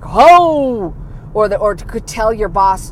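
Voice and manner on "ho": loud, high sassy "Ho!"; mock exclamation. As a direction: snap it out with attitude.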